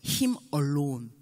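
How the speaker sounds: lightly voiced son, low and drawn out; solemn slow. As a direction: intone it like a reverent vow. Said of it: "이 절 또 꼭 해요".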